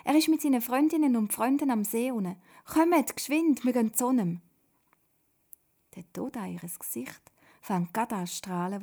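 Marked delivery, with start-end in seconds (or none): 0.0-4.4 high, loud, bursting with news; very excited call. A narrator's reading. Papa calling family to see Jesus, very excited. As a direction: urge everyone to come at once. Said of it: "ruft er ganz aufgeregt".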